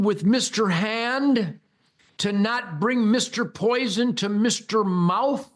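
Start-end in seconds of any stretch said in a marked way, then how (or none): none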